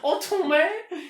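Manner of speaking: high, loud put-on accent on Tome; mock French-British accent. Copying the co-host's accent, hammed-up. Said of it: "is that, like, French and British".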